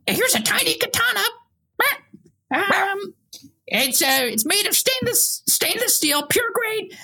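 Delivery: high, shifty dealer voice; shady shopkeeper voice. Acted. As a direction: sell the illegal goods with a wink. Shopkeeper sketch character.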